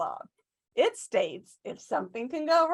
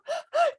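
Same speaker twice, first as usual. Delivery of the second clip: high gasping sob; hammed-up sob gasp; sobbing gasp. Ugly crying gasp.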